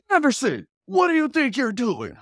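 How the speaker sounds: high, loud scolding; stern coach. Retold or imitated. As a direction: call him out sharply by name. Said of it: "coach is just like".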